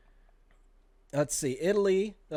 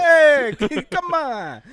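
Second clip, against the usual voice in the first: loud, high Jersey-Italian outburst; hammed-up Jersey impersonation; mock Jersey Italian accent; 11 semitones higher, 9 dB louder, much slower than usual. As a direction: holler like a cartoon Jersey Italian.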